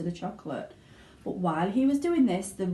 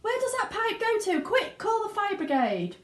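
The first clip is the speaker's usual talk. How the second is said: very high panicked yell; yelling mother; 14 semitones higher than usual. A narrator's reading. Mother yelling as her son vanishes, very high. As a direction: shriek in alarm. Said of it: "yelled Missus Gloop".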